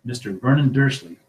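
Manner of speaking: plain reading voice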